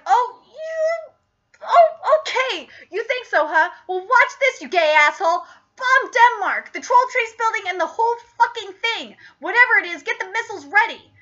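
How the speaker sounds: high-pitched blustering outburst; acted indignation. Garrison goaded into ordering Denmark bombed, indignant. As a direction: rise to the dare, pitched well up.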